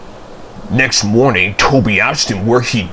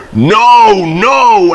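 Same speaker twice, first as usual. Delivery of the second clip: alarmed refusal; 10 semitones higher, 6 dB louder than usual. A narrator's reading. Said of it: "answered Toby".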